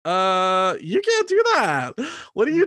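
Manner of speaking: high, loud, incredulous outburst; inner outrage. Retold or imitated. Barista's unspoken reaction to her boss, high and outraged. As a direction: blurt it high and loud in disbelief. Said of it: "In my head, I'm like".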